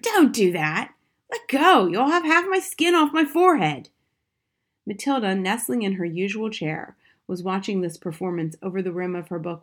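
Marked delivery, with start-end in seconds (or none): 0.0-3.9 loud, high, panicked protest; pained screaming. A narrator's reading. Husband to wife tugging the glued hat, screaming. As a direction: cry out to make her stop. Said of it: "he screamed".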